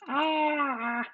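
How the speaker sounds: sudden high cry; a quick startled shout; startled yelp. Real, not acted. Pouring batter in.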